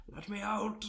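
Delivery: mock trapped cry. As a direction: play a dead man begging to be freed. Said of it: "record previously being like".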